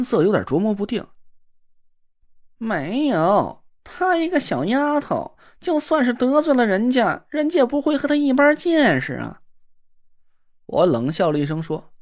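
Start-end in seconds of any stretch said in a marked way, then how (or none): 2.6-9.4 simpering sissy man. Acted. Na Ruolan fawning after the girl who ignores him, simpering. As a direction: coo at her like a fawning dandy.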